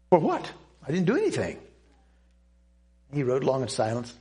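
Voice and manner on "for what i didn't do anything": high, sharp "for what?" in disbelief; indignant surprise. Retold or imitated. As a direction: protest the accusation, startled. Indignant denial to a rebuke.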